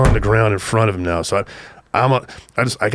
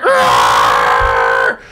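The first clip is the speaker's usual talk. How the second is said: imitated engine roar; 10 semitones lower, 8 dB louder than usual. Car blocks them in.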